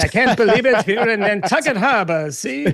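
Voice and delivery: nineteen-forties film star lilt; mid-Atlantic accent. Retold or imitated. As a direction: play it like the Philadelphia Story. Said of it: "it sounds like the Philadelphia story".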